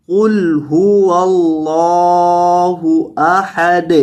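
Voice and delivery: very slow, drawn-out melodic tilawat; Quran recitation. Shown as a demonstration. Teacher reciting the sura slowly for students. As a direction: stretch every syllable in a chanted recitation. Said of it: "اب پوری آیت کو دیکھیں".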